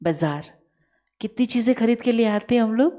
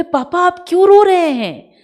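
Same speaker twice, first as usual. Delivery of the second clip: third son, much higher and louder; third son asking; 10 semitones higher, 11 dB louder than usual. Retold or imitated. Third son calls to his weeping father, much higher and louder. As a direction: call out to the crying father. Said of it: "बोले".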